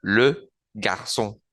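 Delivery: le garçon slowly, le with rounded E, ç as S; slow French demo. Shown as a demonstration. Teacher slowly modelling le garçon for the class. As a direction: stretch out le garçon syllable by syllable. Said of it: "que suena como una S".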